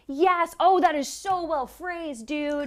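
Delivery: loud, high burst of delight; excited. Real, not acted. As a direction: gush with real excitement. Excited at viewer's comment.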